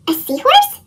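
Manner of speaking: light up at the idea; amazed question. Acted. Pete hearing about seahorses from Captain Joe, amazed.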